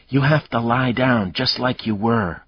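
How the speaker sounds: put-on urgent, fierce command from a frightened girl. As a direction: push it through, fierce and urgent. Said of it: "Grace said fiercely".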